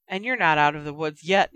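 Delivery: ordinary delivery, nothing marked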